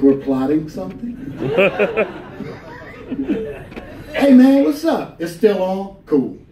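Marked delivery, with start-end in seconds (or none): 4.1-6.4 bright, high friendly hey man, mock cool; casual street greeting. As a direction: toss off a breezy high-pitched hello like passing a stranger.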